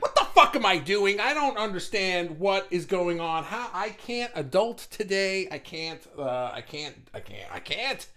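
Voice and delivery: loud exasperated outburst, then fading; overwhelmed rant. Retold or imitated. Host acting out a viewer's overwhelmed morning, loud then fading. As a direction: blurt it out, then run out of steam. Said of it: "just been like".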